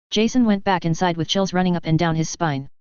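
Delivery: ordinary delivery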